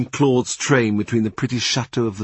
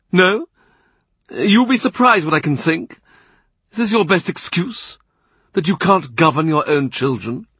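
higher, louder imperious churchman; angry Cardinal; 7 semitones higher, 4 dB louder than usual. A narrator's reading. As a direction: pounce on every excuse, rising in anger. Cardinal mocks Boleyn's excuse.

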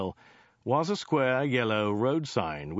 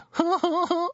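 high-pitched scoffing laughter; high character guffaw; high mocking laugh; 4 dB louder than usual. Joe laughs at his own joke.

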